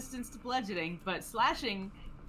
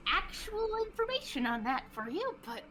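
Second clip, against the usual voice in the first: high, helpless character voice; shrugging innkeeper; 11 semitones higher than usual. Acted. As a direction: offer it with a verbal shrug. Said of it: "she just kinda shrugs and goes".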